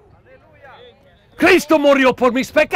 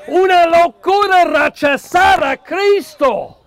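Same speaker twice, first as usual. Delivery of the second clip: ordinary talk, nothing marked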